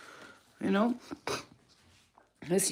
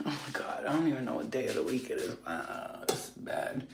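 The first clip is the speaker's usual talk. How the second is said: dismayed whisper; 5 semitones lower, 7 dB quieter than usual. Real, not acted. Realising it's Friday.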